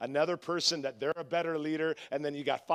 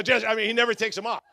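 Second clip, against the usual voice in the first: normal talking voice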